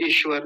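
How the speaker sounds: ईश्वर quick, with short i; wrong short ई. Shown as a demonstration. Teacher showing the shortened vowel, mispronunciation. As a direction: say ईश्वर briefly as ish-var. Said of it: "छोटी की कर देते हैं".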